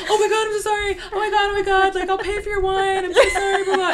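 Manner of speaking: high-pitched, frantic retold apology; panicked apology. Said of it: "I immediately started freaking out".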